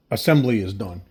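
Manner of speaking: ordinary talk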